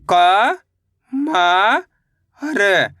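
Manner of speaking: each letter drawn out, with a long pause after it; stretched, separated syllables. Shown as a demonstration. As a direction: sound out the letters one at a time for learners. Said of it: "मेरे साथ साथ इनको repeat".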